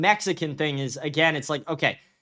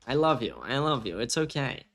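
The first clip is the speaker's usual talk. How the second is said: low, soft murmur to a pet; soothing; 6 semitones lower, 7 dB quieter than usual. Real, not acted. Owner reassuring a freaking-out dog, soothing and soft. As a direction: drop the voice and comfort gently.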